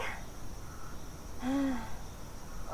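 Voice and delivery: long soft exhale; relieved sigh. Acted. Maiden finally rid of the prince, relieved sigh. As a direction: let all the tension out in one breath.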